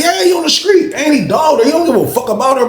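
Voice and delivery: very high-pitched retort, retold; heated comeback. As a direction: argue back incredulously, pitch shooting up. Retold argument, arguing back loudly.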